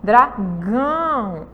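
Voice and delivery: "Dragão" drawn out slowly with a nasal "ão". Shown as a demonstration. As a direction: let "Dragão" ring in the nose, very slow. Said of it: "deu uma tremidinha".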